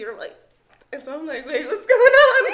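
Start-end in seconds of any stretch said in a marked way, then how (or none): 1.5-2.5 very high, loud exclamation through laughter; hammed-up, laughing confusion; retold bewilderment. Retold confusion as partner approaches.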